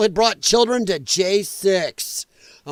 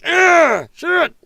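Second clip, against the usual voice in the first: mock groan; 9 semitones higher, 6 dB louder than usual. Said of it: "Scott's like".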